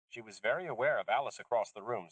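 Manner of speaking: ordinary delivery, nothing marked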